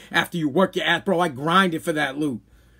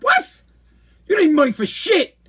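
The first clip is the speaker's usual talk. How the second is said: high-pitched retold outburst; incredulous anger; 9 semitones higher, 3 dB louder than usual. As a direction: shoot back in disbelief, voice jumping up. Retold angry confrontation with mother.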